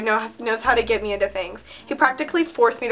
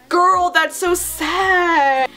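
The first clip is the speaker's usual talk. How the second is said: slow, higher-pitched teasing pity; long, pitched-up drawl of pity; mock pity; 6 semitones higher, much slower than usual. Real, not acted. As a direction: draw it way out in mock sympathy. Teasing pity for younger self.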